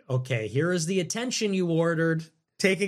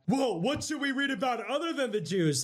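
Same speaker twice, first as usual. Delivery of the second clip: higher, softer sarcastic gasp; mock amazement; 9 semitones higher, 3 dB quieter than usual. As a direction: feign being dazzled by the question. Mock-rereading a donor's question.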